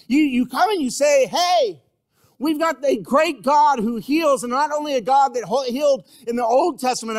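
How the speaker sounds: high, loud, driving sermon voice; impassioned preaching. Acted. Preacher voicing a believer asking about unanswered prayer, impassioned full-force delivery. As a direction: build a fervent protest at full force.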